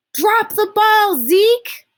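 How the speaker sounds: high, loud, drawn-out crowd yell. Shown as a demonstration. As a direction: yell it like a whole team calling out. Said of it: "So I had to yell that part".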